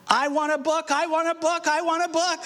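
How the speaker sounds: put-on high whiny child's demand. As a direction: whine it like a toddler begging. Child wakes, demands book.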